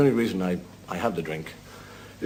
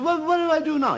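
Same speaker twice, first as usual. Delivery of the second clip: put-on clueless drunk. Novice drunk asking his friend how to fish, high helpless voice.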